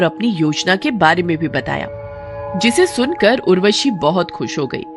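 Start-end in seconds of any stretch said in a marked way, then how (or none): none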